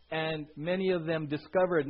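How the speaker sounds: ordinary talk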